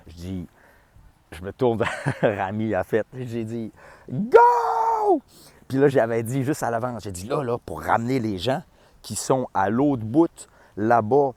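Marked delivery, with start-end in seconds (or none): 4.1-5.2 high, loud shouted cue. Retold or imitated. Stage manager cueing the artist, loud shout. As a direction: yell the start signal at the artist.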